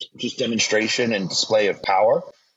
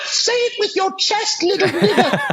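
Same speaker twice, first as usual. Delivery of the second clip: mock queen voice. Queen goading the losing prime minister, very high mocking voice. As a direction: draw it out high like a teasing old monarch. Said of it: "she's like".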